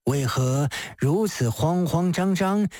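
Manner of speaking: low, probing magistrate voice; measured official questioning a flustered woman; Di Renjie. Acted. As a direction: ask with composed, low-pitched concern.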